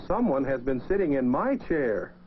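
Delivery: plain reading voice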